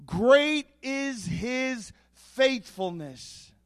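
slow, reverent reading of the verse; measured scripture recital. Acted. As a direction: read it slowly and reverently, savouring each word. Reads scripture slowly.

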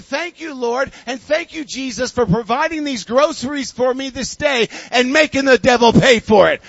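rising to a loud joyful praise; triumphant praise. Retold or imitated. Grandma thanking the Lord over the groceries, building to joyful loud praise. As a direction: crow with joy at the end.

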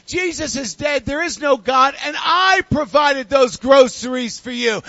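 taunting shout. Retold or imitated. The atheist next door taunting Grandma, a loud gloating shout. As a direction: gloat at her and yell it.

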